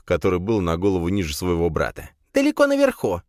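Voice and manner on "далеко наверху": very high squeaky creature. A narrator's reading. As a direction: chirp it like a small beast. Squeaky son Krisp speaks.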